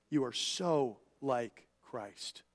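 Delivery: tender slow emphasis; soft and slow. Real, not acted. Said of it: "really let this settle in your mind".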